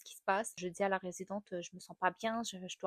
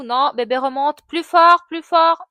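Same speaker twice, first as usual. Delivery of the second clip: loud, high urging shouts; doctor urging; 7 semitones higher, 18 dB louder, slower than usual. Retold or imitated. Doctor urges the mother to push harder, loud shouts.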